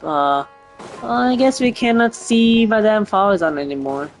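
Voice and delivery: ordinary talk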